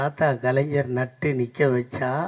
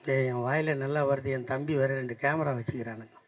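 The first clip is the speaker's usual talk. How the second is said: ordinary talk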